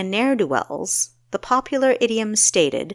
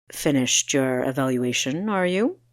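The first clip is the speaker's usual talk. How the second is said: calm, unhurried man's voice, a touch lower; coolly composed, barely bothered; serene; 4 semitones lower than usual. A narrator's reading. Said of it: "he asked serenely".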